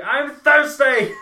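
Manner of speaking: high, drawn-out loud yell; imitated scream. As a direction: holler it slowly, hammed up. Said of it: "I did scream, "I'm thirsty".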